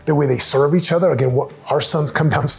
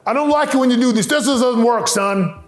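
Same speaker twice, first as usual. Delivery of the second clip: higher, rising, nagging reprimand that keeps climbing; scolding dad; 7 semitones higher than usual. Retold or imitated. Dad scolding his son for misbehaving, mock scolding tone. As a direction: play the fed-up dad wagging a finger. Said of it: "Sometimes I'm like".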